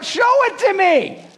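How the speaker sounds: high, slow, longing plea; pleading Moses. Pretend. Preacher voicing Moses begging to see God's glory, high and slow.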